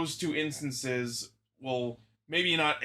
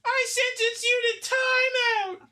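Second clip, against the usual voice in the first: very high, slow mock sentencing; judge's pronouncement; 4 dB louder, slower than usual. Playing a judge handing down timeout, squeaky and grand. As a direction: draw it out in a squeaky high put-on voice.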